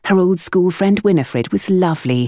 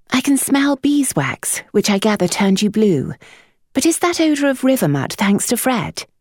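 higher, dry governess voice; higher measured lady; composed lady; 7 semitones higher than usual. A narrator's reading. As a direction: raise an amused eyebrow. Eugenia questions the boy.

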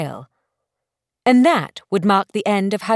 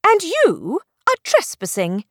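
higher, indignant comeback; sharp retort; 7 semitones higher than usual. Acted. Belle hitting back at the stranger, indignant retort. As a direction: fire it straight back at him. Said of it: "she retorted".

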